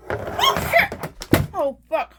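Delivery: sudden real alarm, high and loud; startled swearing. Real, not acted. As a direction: blurt it out as the resin spills. Resin spills on camera.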